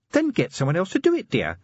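high, gently amused lady; a patient, teasing wife; genteel lady voice. Acted. Sybil speaks.

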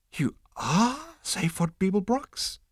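high, slow squeak of disbelief; squeaking insect. Acted. Stunned insect squeaking at Zaphod. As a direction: squeak it out awestruck. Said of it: "it squeaked".

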